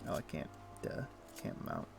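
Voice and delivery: normal talking voice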